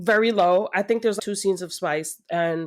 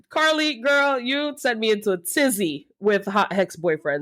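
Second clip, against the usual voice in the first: normal talking voice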